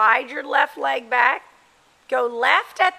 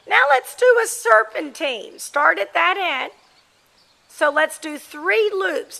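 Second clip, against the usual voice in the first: calling across the arena, higher and louder; brisk projected riding commands; 5 semitones higher, 3 dB louder than usual. Real, not acted. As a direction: project the command to a rider far off.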